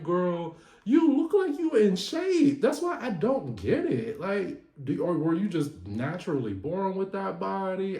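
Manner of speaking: high-pitched, baffled mock telling-off; incredulous scolding. Ribbing Trina for losing despite looking fit, high incredulous voice. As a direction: go high, as if you cannot believe it.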